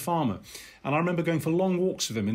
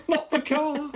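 high mock wail breaking into laughter; hammed-up pleading child; 13 semitones higher, slower than usual. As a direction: imitate a play-acting toddler, laughing as you plead. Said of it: "He was acting".

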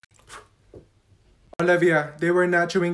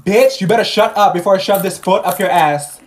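loud hostile threat; angry threat; 8 dB louder than usual. Acted. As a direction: threaten her loudly. Threat in class.

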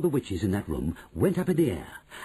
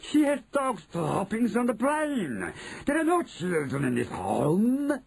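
put-on high, scornful accented witch; imperious shout; 12 semitones higher than usual. Grand High Witch dismisses Mildred, high scornful cry. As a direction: rant it high as the witch leader. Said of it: "shouted the Grand High Witch".

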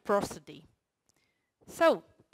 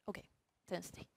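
ordinary talk, nothing marked

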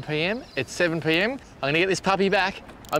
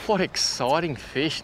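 normal talking voice

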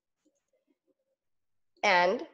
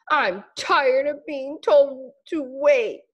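slow, sulky complaint, a little higher; fed-up caterpillar; 5 semitones higher, 4 dB louder, slower than usual. Acted. Caterpillar complaining, slow and sulky. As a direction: grumble your frustration.

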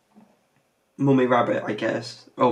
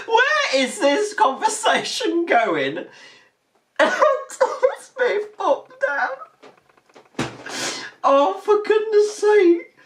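giggling disbelief; 12 semitones higher than usual. Real, not acted. Reactor laughing at clip.

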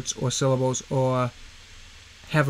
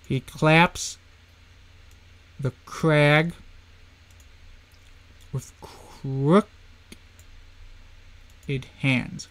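He claps the crag with crooked hands, each beat hit with long pauses between; slow, beat-by-beat scansion; beats highlighted; much slower than usual. Shown as a demonstration. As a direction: read crooked and hands with heavy stress and gaps. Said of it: "So I'm gonna highlight the beats".

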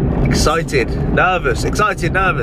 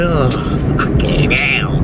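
exasperated viewer; much slower than usual. Retold or imitated. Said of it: "if you're watching this and going".

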